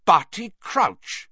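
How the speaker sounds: high, loud, slow cry of the name; stunned exclamation. A narrator's reading. Snape recognising the man on the floor, stunned. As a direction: gasp it out in astonishment. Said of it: "stopping dead in the doorway".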